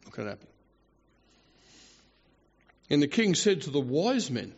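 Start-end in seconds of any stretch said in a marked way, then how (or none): 0.0-0.5 low, quiet, dismissive refusal; queen's refusal. Retold or imitated. Queen refuses to parade before the men, quietly dismissive. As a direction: refuse under your breath, unbudging. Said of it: "being told by the queen".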